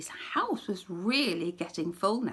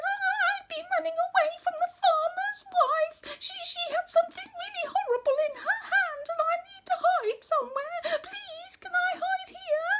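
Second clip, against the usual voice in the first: very high, tearful little mouse; high trembling mouse begging to hide; frightened squeaky mouse; 5 dB louder than usual. Acted. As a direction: squeak out the plea like a scared mouse. Mouse flees farmer's wife.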